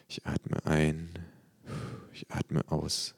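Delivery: slow, soft breathing exercise; murmured mantra. A narrator's reading. Patient alone calming himself, slow soft murmur. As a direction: murmur it slowly with each breath. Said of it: "murmel ich".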